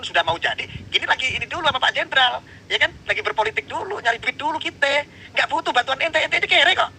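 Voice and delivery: very high, hammed-up exasperated voice; mocking shrill outrage. Host mocking Kori as a fake descendant, shrieking. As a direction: lampoon him in a squealing falsetto.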